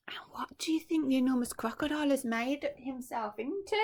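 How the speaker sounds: hushed conspiratorial whisper; secretive whisper. Real, not acted. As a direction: draw listeners in with a hush. Reader whispers a question.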